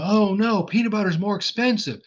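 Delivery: high, loud mock alarm over the price; mock shopper dismay. Teacher voicing a shopper shocked by peanut butter prices, high and loud. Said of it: "They go".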